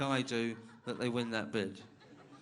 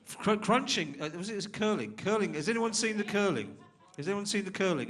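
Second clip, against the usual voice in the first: calling out; 8 semitones higher, 6 dB louder than usual. Real, not acted. MC calling out to the crowd.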